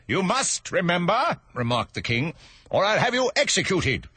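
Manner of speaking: high, threatening command; menacing king. Acted.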